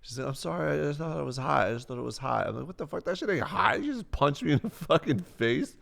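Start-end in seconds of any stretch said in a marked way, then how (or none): 0.3-2.4 high mock-girl excuse; imitated apologetic girl. Voices girl excusing the punch. 2.7-5.8 high incredulous protest; mock indignation. Retells protest to the girl.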